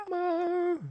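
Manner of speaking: sudden high yelp of a callout; excited high in-game callout. Real, not acted. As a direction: yelp it out as you grab the pickup. Calling out in game.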